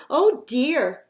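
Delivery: startled by the fall; gasp of surprise. A narrator's reading. Gasp as he tumbles.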